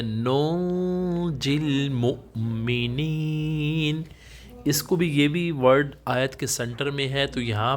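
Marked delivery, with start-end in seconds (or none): 0.0-4.0 slow recitation with the نون hidden in the nose; Quran recitation. Shown as a demonstration. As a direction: chant it, hiding the نون nasally. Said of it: "یہ word ہے". (ناک میں نون کا اخفا کر کے تلاوت)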